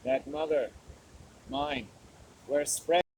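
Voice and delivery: slow, grand archaic recitation with long pauses; lightly hammed-up solemn verse; mock poem recital. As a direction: declaim like a bard in a mock-heroic poem.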